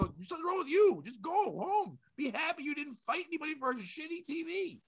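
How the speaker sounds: high-pitched retold telling-off; worked-up, incredulous comeback; exasperated scolding. Retold scolding of a quarrelling customer.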